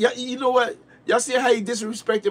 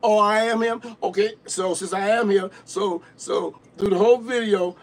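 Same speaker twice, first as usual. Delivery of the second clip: usual speaking voice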